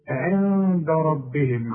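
عند ربهم slow, the ن hidden into د with nasal hum; slow nasal hiding. Shown as a demonstration. Teacher demonstrating ikhfa. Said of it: "من حروف الإخفاء".

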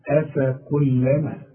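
أفكلما drawn slowly with a thin, light alif; slow light recitation. Shown as a demonstration. Teacher showing the light letters. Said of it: "شوف الألف ولا الهمزة مرققة إزاي".

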